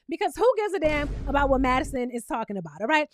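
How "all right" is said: ordinary talk, nothing marked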